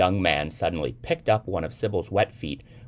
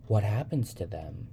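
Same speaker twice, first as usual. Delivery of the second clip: put-on slightly high, small girlish question; lightly voiced curious little girl; curious child; 5 semitones higher than usual. A narrator's reading. Sybil asking the young man about bananafish, curious.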